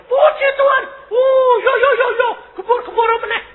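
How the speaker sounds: high, loud mock rejoicing; hammed-up squealing praise; acted jubilant shouting. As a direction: whoop it out as the overjoyed husband. Acts out husband rejoicing at recovered voice.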